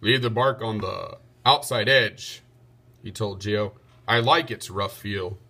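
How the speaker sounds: a knight instructing his carpenter; knight's voice. A narrator's reading. Circumference instructs Geo.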